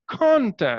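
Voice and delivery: "Contact" with a strong high CON, falling onto a light TACT; stress on CON. Shown as a demonstration. Teacher demonstrating to a student, "Contact" stressed on CON. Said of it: "I'm stressing upon con".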